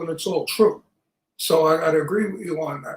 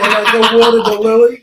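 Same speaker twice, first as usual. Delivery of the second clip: loud, raised jokey announcement; jokey loud request; 3 semitones higher, 10 dB louder than usual. Real, not acted. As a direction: call it out over the laughter.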